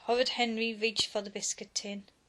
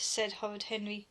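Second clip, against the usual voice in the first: ordinary delivery, nothing marked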